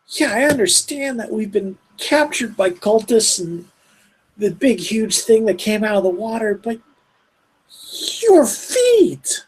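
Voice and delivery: put-on high, fed-up old woman voice; exasperated old woman. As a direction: voice Ma Tabby complaining. GM voicing Ma Tabby complaining.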